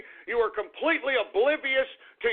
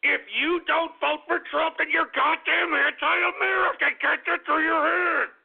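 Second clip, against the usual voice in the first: higher, heated hammering home; angry rant; 6 semitones higher than usual. Acted. Ranter telling non-Trump voters off, heated higher rant. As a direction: drive it in like a final warning. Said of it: "I'm a little upset and I'm a little angry".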